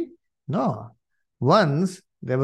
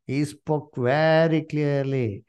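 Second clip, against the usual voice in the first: slow, deliberate; slower than usual. Shown as a demonstration. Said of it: "Underline it".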